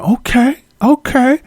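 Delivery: mock woman praising her man; impressed woman. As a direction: coo approval of your man up high. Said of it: "She going".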